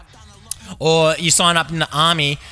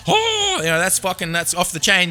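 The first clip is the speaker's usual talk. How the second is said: high-pitched "oh"; mock amazement; 3 semitones higher than usual. Reacting to being called mate, mock amazement. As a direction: play up the thrill with a high exclamation.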